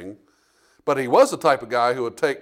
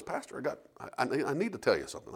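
confidential tone; 12 dB quieter than usual. Retold or imitated. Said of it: "he goes".

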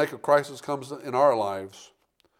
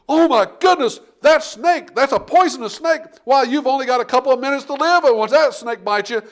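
high, loud panicked bystanders; alarmed onlookers; 10 semitones higher, 6 dB louder than usual. Retold or imitated. Preacher voicing the shocked crowd, high and loud. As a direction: play the crowd gasping at the snakebite. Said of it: "I can just imagine everybody there going".

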